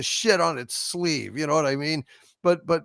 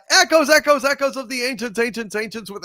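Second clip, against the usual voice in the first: repeating the name louder and higher at first, then fading away like an echo; mock echo; 8 semitones higher, 4 dB louder than usual. Host reading out a super chat donor's name, mock echo fading. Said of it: "echoey one".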